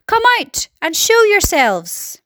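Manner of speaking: high, slow calling command; drawn-out, high-pitched order; shouted order. A narrator's reading. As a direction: call them out from a distance. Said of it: "shouted the chief of police".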